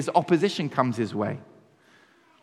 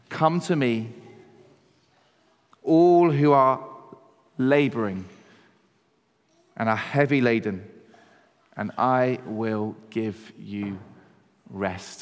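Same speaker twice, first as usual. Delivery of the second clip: tender and unhurried, sinking almost to nothing at the end; gentle invitation; 4 semitones lower than usual. Acted. Quoting Jesus's gentle invitation.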